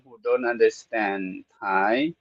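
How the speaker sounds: normal talking voice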